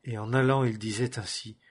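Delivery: ordinary delivery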